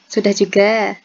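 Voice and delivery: usual speaking voice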